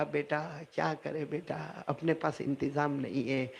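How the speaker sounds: soft, low, slow tearful voice; mother crying, telling her son they cannot afford it; weeping mother. Acted. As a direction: speak through tears, barely audible. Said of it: "اب ماں بھی روئی".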